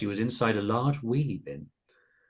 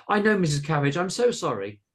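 high-pitched pleading apology; 8 semitones higher, 4 dB louder than usual. A narrator's reading. Carolina apologising to Missus Cabbage, pleading. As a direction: beg forgiveness in a high voice. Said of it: "pleaded Carolina".

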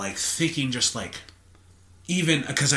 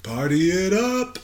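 cheering; 10 semitones higher, 5 dB louder than usual. Acted. Cheering on tipsy friend.

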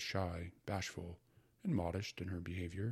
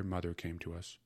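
plain reading voice

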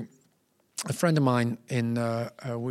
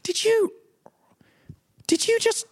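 very high, strained inner voice; mock-horrified self-interrogation, breaking into whisper; horrified disbelief; 13 semitones higher than usual. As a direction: squeak it out in halting, aghast questions. Horrified inner voice after insulting star.

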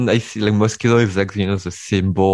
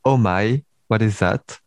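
usual speaking voice

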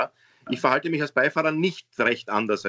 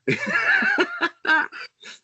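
high laughter. Real, not acted. Laughs after greeting.

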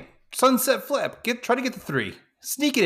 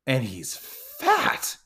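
mock outrage; much slower than usual. Mock outrage at beer gut.

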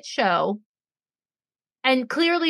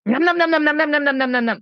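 nom nom nom, louder, chewing greedily; mock chomping noises; 3 semitones higher, 4 dB louder, faster than usual. Imitating Vivi eating fast, mock chomping. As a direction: play a kid gobbling food. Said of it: "Vivi's like".

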